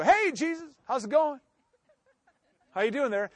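high, breezy hey Jesus; mock casual greeting. Distracting Jesus with a breezy high greeting.

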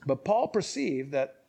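usual speaking voice

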